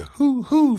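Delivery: two high, loud imitated owl hoots. Camper imitating owls from another direction, high loud hooting. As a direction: answer like a second owl. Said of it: "Then we heard a".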